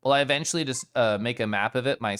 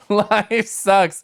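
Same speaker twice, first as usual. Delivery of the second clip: laughing; 9 semitones higher, 9 dB louder than usual. Real, not acted. Laughing repeat.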